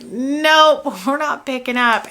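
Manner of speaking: high-pitched, emphatic brush-off; mock refusal. Friends see your name on the phone, mock refusal.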